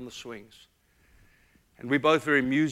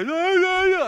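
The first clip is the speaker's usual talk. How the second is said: high squeaky imitation of a defiant little girl; defiant child; 9 dB louder, slower than usual. Father imitates his little daughter's defiant refusal, high and squeaky. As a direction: mimic a small daughter refusing her dad. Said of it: "daughter answering back".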